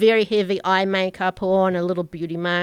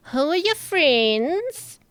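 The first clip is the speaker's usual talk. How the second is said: slow, higher suspicious question; curious Orla; 7 semitones higher, slower than usual. Acted. Orla asks Tal about her companions, slow and probing. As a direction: draw it out, sizing them up.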